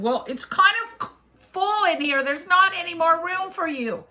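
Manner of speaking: high animal voice, turning someone away; reluctant animals. Acted. Animals telling the frog it's full, high and reluctant.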